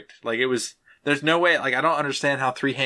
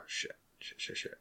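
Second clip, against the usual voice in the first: unvoiced frustrated swearing under the breath; whispered cursing; 19 dB quieter, slower than usual. Real, not acted. Trying to recall a name, whispered swearing. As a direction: whisper the curse at yourself.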